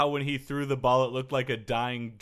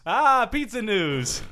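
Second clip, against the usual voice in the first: higher, slow mock Italian; jolly italian pizza chef; 8 semitones higher, 5 dB louder, slower than usual. Echoing the Italian joke.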